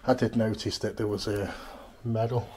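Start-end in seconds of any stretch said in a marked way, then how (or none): none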